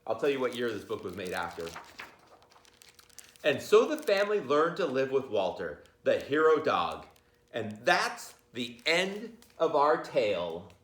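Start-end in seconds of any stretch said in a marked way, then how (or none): 3.4-10.7 storybook ending. A narrator's reading. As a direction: wrap up the tale for the class. Teacher closes the story.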